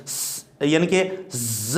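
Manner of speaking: soft "س" and "ز" hissed slowly and quietly; hissing letter demo. Shown as a demonstration. Teacher showing students one alif of whistle, soft hiss demo. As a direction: let the whistle of "ز" sound briefly. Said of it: "آواز کو یہاں تک لے کے آنا ہے ایسے".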